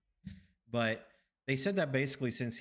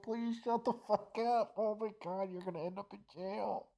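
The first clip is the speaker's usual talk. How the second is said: retold pleading in a higher, thinning voice; mock desperate plea; 11 semitones higher than usual. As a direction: squeak out a comic plea to stop. Retold inner plea, laughing.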